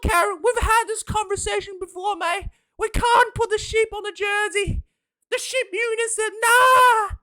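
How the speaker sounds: very high, over-the-top cooing official; gushing rugby official. Retold or imitated. Rugby board official praising a kid's scribble, very high and gushing. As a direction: coo at the kid's drawing like a doting boss. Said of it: "New Zealand Rugby looked at it and went".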